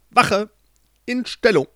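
rally the guards to arms; shouted command. Acted. Queen calls her guards.

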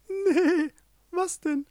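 very high-pitched bleating sheep voice. A narrator's reading. Sheep answers the ram, very high bleating voice. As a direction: squeak it out like a silly sheep. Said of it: "das Schaf".

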